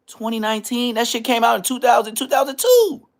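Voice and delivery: rising high and loud in disbelief; incredulous. Real, not acted. Mocking the pastor's old jersey in shocked disbelief. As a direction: pitch climbs up and up, disbelieving.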